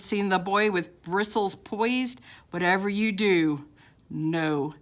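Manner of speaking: usual speaking voice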